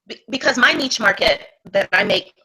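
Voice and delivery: normal talking voice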